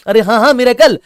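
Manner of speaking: hammed-up cry of miracle; mock miracle cry. Student boasting after one day, mock shout. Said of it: "फिर बोले".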